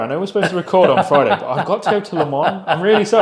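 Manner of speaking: hammed-up grovelling apology with a laugh in it; mock apologetic caller. Friend teasing the host's apologetic phone call, mock sorry. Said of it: "you called me on the Monday saying".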